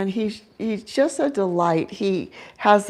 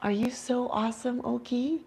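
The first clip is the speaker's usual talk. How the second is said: pet talk; 4 semitones higher, 9 dB quieter than usual. Real, not acted. Talking to the pet owl.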